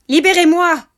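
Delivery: high, loud demand to be freed; trapped dwarf's shrill-pitched shout; crying out. A narrator's reading. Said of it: "s'écria le nain".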